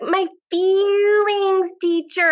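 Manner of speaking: put-on high, very slow, careful child's voice; little daughter's voice. Daughter's punchline in her voice.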